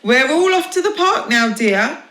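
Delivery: high, loud cheery announcement; announcing. Acted. Husband announces the park trip to his wife. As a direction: proclaim the outing brightly. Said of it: "he announced".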